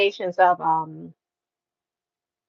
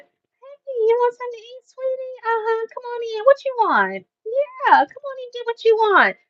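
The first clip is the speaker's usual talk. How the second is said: high, soft, syrupy welcome; sugary Southern host; 12 semitones higher, 5 dB quieter than usual. Retold or imitated. Southern locals inviting a visitor in to eat, syrupy high. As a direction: lure them in like a sweet hostess. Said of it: "these people be like this".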